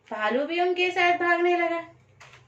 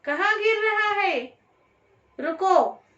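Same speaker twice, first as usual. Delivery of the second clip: high, roaring lion's demand to stop; 6 semitones higher than usual. A narrator's reading. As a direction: roar it out like an angry lion. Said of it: "शेर ने जोर से दहाड़ कर".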